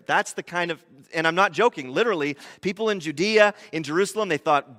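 usual speaking voice

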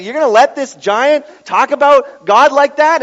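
put-on a brave shepherd boy calling out the giant; bold defiant David. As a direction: challenge them, indignant and loud.

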